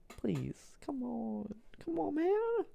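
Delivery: Czechia voice. Acted. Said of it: "And Czechia is like".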